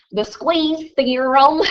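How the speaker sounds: put-on answering the teacher in a thick Southern accent. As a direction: drawl "The squeeze theorem" like a small-town Kentucky girl. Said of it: "very Southern".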